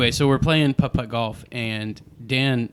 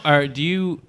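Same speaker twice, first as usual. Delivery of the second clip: ordinary talk, nothing marked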